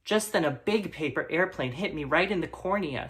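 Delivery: ordinary talk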